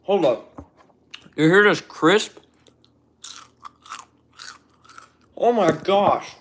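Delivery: loud, high excited outbursts. Real, not acted. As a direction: blurt it out thrilled with food. Excited first crunchy bite.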